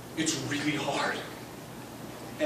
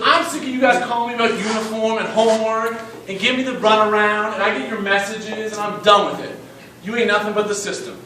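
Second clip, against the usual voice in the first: loud, higher-pitched fed-up outburst; angry rant; 6 semitones higher, 6 dB louder than usual. Retold or imitated. Dad fed up with calls from school, ranting angrily. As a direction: fire the complaints off as an irate parent.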